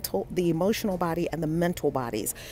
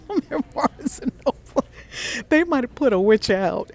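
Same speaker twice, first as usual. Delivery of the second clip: giggly aside; 6 semitones higher, 3 dB louder than usual. Real, not acted. Laughing about being in store.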